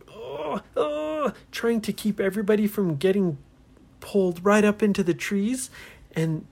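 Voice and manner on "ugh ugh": high, straining "ugh"; effortful grunts. Acted. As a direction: grunt as if hanging on hard. Holding on to tree trunks.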